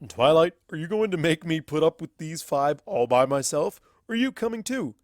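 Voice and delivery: gruff mentor giving in; lightly voiced crusty old wizard; Starswirl's voice.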